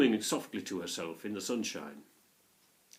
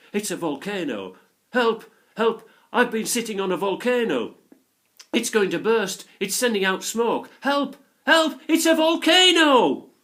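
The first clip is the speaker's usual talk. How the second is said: panicked screams, much higher and louder, climbing to a shriek; screaming loudly; 12 semitones higher, 13 dB louder than usual. A narrator's reading. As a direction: scream for help as she flees down the hill. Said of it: "screaming loudly".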